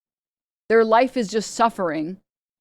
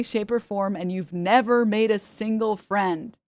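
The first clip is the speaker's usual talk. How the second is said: sarcastic mock sympathy; 4 semitones higher than usual. Streamer mock-reading a troll's profile, sarcastic pity. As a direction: coo over them like a rare specimen.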